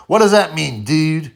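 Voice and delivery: high, loud confused American voice; American guy. Retold or imitated. American pal asking what septic means, high and loud. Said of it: "He goes".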